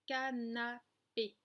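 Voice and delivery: put-on "canapé" measured out for young pupils; syllable by syllable. Shown as a demonstration. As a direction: say "canapé" slowly, split into three syllables. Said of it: "le nombre de syllabes".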